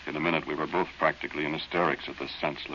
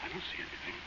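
whispered and much softer, afraid of being heard; scared whisper; 13 semitones higher, 13 dB quieter than usual. Acted. Boy to Dorothy at night by the well, scared whisper. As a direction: hush it, something is out there in the dark.

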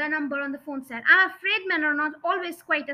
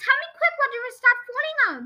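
high, fast, urgent wail; frantic plea in a raised voice; panicked wail; 10 semitones higher, 4 dB louder, faster than usual. A narrator's reading. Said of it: "wailed the father".

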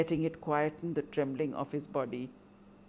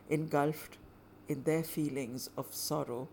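ordinary delivery

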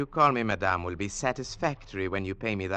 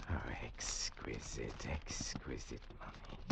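soft, much quieter, breathed out to a whisper; greedy whisper; 10 semitones lower, 14 dB quieter than usual. Acted. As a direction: murmur over the cash as if caressing it. Villain counting the money greedily.

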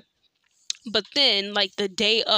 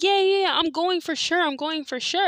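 mock eager promise; 8 semitones higher than usual. Flaky friend's eager promise mocked.

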